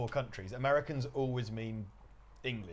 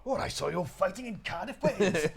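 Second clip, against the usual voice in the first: loud, higher-pitched Welsh singer voice; 6 semitones higher, 3 dB louder than usual. Retold or imitated. Host impersonates the Welsh singer, showy Welsh accent. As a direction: put on a showy Cardiff accent. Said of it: "whilst we're doing [name]".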